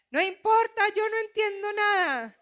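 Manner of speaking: a sulky daughter refusing to listen; pouty little girl. Retold or imitated. Mother voices her daughter sulking over homework, high and whiny.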